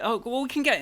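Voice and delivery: high, hurried offer to help; imitating the organiser. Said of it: "the organizer sort of going".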